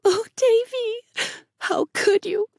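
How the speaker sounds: high, slow, near tears, trailing to a whisper; quivering voice. A narrator's reading. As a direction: hold back tears as you ask. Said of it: "with a quiver in her voice".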